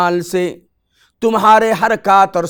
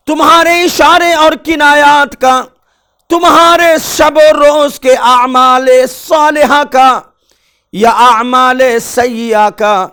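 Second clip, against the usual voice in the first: higher and louder, hammering the list; rising fervour; 7 semitones higher, 5 dB louder than usual. Acted. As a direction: drive the list home with force. Building sermon list.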